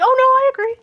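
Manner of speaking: ordinary delivery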